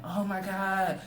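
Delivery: high, loud panicked mom voice; distraught mom. Retold or imitated. Son tells about his mother panicking over his fighting, high mock wail. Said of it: "she's distraught and everything".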